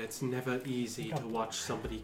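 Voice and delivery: soft, low comforting murmur; gentle husband comforting his shaken wife; consoling husband. Acted. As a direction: soothe her quietly.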